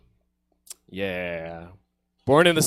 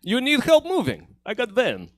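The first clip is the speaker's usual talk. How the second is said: Russian mover; 9 semitones higher than usual. Pretend. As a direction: pitch a moving job like a Russian mover. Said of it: "I'm not wearing a tracksuit".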